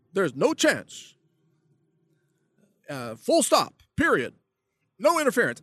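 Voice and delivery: lightly mock Obama with a Texas twang. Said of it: "I'm giving Obama a Texas accent".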